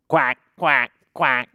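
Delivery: loud quacking; quick, loud duck calls for kids. Acted. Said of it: "That's the duck sound".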